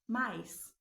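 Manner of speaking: "Mais." curtinho, como uma criança, mais forte; imitando a criança. Retold or imitated. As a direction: diga como um bebê pedindo mais. (imitando a criança pedindo mais)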